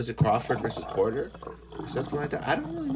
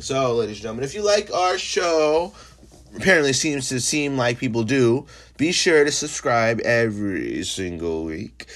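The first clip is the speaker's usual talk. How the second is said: hyped podcast plug; 2 semitones higher, 5 dB louder than usual. Acted. Host hyping listeners to subscribe, booming announcer voice. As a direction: sell the show like a ring announcer.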